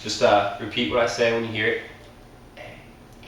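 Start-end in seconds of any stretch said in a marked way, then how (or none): 2.6-3.3 whispered letter for hearing test; whispering. Shown as a demonstration. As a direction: breathe it with no voice. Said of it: "uh, whisper test".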